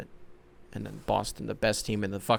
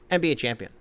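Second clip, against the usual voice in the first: unimpressed; faster than usual. Pretend. Host reading a minor player's title, unimpressed. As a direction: toss it off with no hype. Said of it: "Can't be disrespectful".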